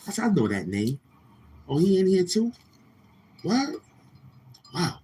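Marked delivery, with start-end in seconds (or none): 1.7-5.0 much higher, sarcastic surprise; high-pitched fake astonishment; mock surprise. Real, not acted. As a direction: act shocked he showed up. Spots the troll in chat.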